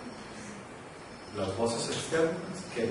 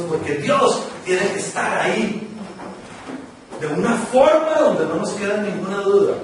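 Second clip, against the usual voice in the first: loud, raised preacher's voice; impassioned preaching; 8 semitones higher, 8 dB louder than usual. Real, not acted. Preacher telling congregation God speaks in a murmur, impassioned. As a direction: preach it out forcefully, voice lifted high.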